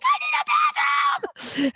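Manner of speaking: drawn-out, very high mimicked scream; desperate shriek. Friend bursts into spa needing toilet, long high shriek. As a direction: imitate someone shrieking in desperation, stretched out.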